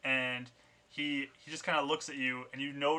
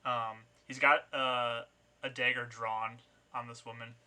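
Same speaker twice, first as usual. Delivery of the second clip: ordinary talk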